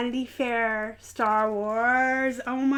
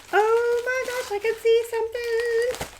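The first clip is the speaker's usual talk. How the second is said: excited squeal; 11 semitones higher than usual. Real, not acted. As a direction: gasp it out, thrilled by the gift. Excited gasp opening a gift.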